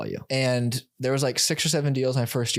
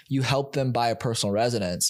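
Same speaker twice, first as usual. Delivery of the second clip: usual speaking voice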